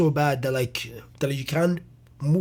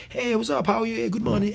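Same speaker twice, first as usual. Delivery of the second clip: bright, much higher greeting voice; high chirpy good-morning voice; cheery old self; 8 semitones higher than usual. Retold or imitated. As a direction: greet everyone like the happiest kid in school. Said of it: "I was a happy, smiley".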